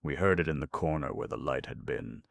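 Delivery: plain reading voice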